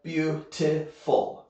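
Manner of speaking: beautiful broken into "byu-ti-ful"; syllable by syllable. Shown as a demonstration. Teacher counting syllables for learners, beautiful said piece by piece. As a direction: say beautiful slowly, a gap between its three syllables. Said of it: "three syllables".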